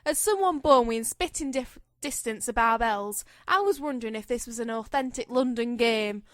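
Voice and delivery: light put-on Cockney accent. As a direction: read it as a hammed-up London Cockney. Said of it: "You have to do the accent".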